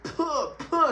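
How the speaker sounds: sputtering spit; spitting noise. Retold or imitated. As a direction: spit the smoke out with disgust. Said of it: "spitting that shit out".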